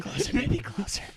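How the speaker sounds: soft, voiceless whispering; playful whispered sweet nothing; mock whisper. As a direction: whisper it into his ear, jokingly. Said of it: "whispering in his ear".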